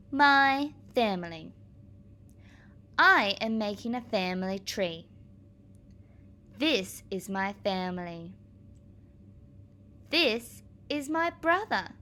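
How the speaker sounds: clear, deliberate picture-book read-aloud; reading to children. Acted. Teacher reading a family book to her class, clear and deliberate. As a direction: read each page out for the little ones.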